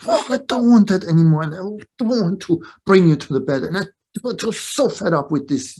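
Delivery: loud, high mock tantrum; acted freak-out. Mother faking a bedtime freak-out before daughter, hammed-up exasperation. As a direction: throw a hammed-up exasperated fit. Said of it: "you just freak out".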